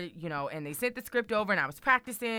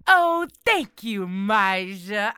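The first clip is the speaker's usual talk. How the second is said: imitating Kamala Harris; 8 dB louder, much slower than usual. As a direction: mimic her warm slow thanks. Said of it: "this is exactly what she said".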